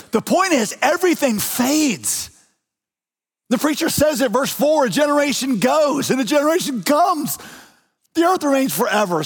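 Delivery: emphatic preaching. Real, not acted. Preacher declaring a verse to the congregation, high and emphatic.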